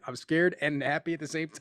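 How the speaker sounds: ordinary delivery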